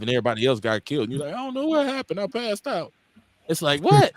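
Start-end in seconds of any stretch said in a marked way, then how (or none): 1.3-2.9 high-pitched, put-on innocence; higher voice, mocking his excuse; mock feeble excuse. Imitates a cowardly man's excuse. 3.8-4.1 high, loud, incredulous "what"; sharp rising outburst of disbelief. Retold or imitated. Incredulous reply to the excuse.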